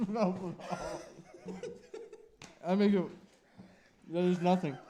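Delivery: laughing. Real, not acted. Laughing in pain from hot chip.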